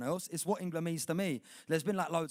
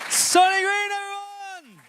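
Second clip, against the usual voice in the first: high, loud announcer call; host announcement; 13 dB louder, slower than usual. Real, not acted. Host introducing the poet to the crowd, loud and high. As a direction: hype up the name for applause.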